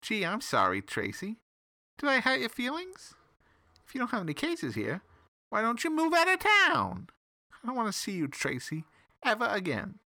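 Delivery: high, sneering taunt; mocking villain. A narrator's reading. Sly taunts Tracy to leave town, high and mocking. Salamander mocks the detective.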